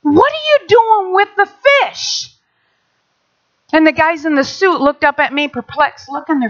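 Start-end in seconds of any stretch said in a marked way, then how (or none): 0.0-2.3 high, slow insistent wait; urgent protest. Retold or imitated. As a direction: stop them in alarm. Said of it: "and I said".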